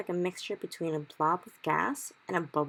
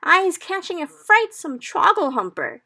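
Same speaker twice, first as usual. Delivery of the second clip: much higher, slow loud cry; cried; 13 semitones higher, 13 dB louder than usual. Acted. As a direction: cry it out in horror. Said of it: "he cried".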